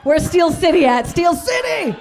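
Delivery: loud crowd call-out. Real, not acted. Comic calling the crowd, loud shout.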